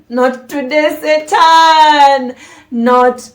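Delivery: loud, high cry at Satan; mock exorcism shout. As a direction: rebuke Satan loudly. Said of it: "Lord, Please, not today".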